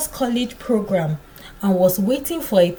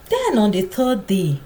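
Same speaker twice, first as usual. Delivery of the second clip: usual speaking voice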